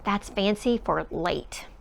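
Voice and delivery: plain reading voice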